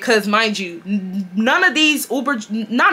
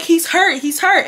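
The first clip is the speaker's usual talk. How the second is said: dramatic alarm; 7 semitones higher than usual. Retold or imitated. Acts out her dramatic alarm.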